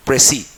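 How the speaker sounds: "प्रेसी" said pray-see, no final s; "प्रेसी" with the s left silent; French pronunciation. Shown as a demonstration.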